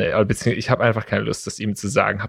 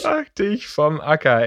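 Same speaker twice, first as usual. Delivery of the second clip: put-on slow, drawn-out old-man grumble; a hint of a cranky old man; grumpy old man; 5 semitones higher, slower than usual. Echoing the line to his cohost, slow old man. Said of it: "so ein alter Mann".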